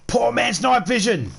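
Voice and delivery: high, delighted exclamation after a gasp; sudden thrilled discovery, said high; real gamer delight at a lucky find; gasping excitement. Real, not acted. Gasps at finding an item.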